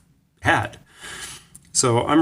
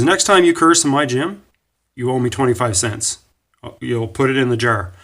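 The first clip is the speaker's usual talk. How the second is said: pretty angry; 3 semitones higher, 5 dB louder than usual. Retold or imitated. Coach warns gymnast about the curse jar, pretty angry. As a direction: lay down the rule crossly, then ease off. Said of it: "he sounded pretty angry".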